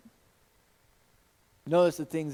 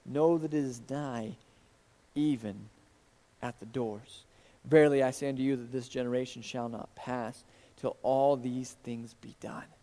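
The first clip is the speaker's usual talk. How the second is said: scripture reading; 4 semitones lower, 4 dB quieter than usual. Acted. Pastor reading the gospel to his congregation, solemn reading. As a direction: read the verse with solemn weight.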